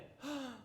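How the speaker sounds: sharp high intake of breath; quick, surprised gasp; astonished gasp. Acted. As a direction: gasp at the sudden healing. Healed woman's astonished gasp.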